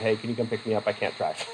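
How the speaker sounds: a hint of a scared teen on the phone; quiet retold plea. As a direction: mumble it like a shaken kid calling home. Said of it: "called his dad and said".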